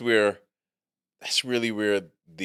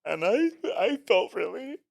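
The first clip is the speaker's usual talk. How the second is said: high, slow, wounded whine; mock offended complainer; 13 semitones higher than usual. Man mimicking today's offended worker, mock-hurt high voice. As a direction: put on a high victim voice, slowly. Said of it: "You say that now".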